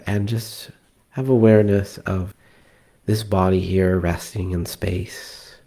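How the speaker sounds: calm meditation guide with long pauses; guided meditation. Acted. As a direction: ease them into relaxed breathing, leaving space between phrases. Leads a breathing meditation.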